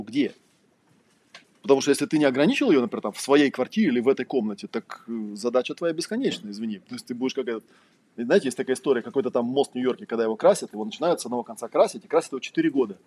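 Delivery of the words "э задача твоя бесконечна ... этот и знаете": normal talking voice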